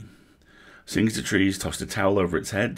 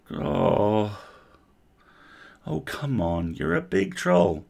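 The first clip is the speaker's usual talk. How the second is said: coaxing; 4 semitones higher, much slower than usual. Acted. Coaxing a scared troll.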